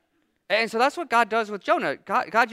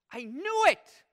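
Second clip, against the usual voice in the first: bitter Jonah; 9 semitones higher, slower than usual. Acted. Voicing Jonah's anger.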